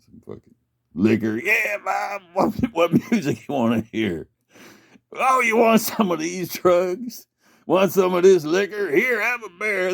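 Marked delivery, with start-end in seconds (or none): 1.4-9.9 high, hammed-up party host voice; mock party buddies. Partygoers egging him on with drinks, high hammed-up voice. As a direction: play the pushy friend offering drugs.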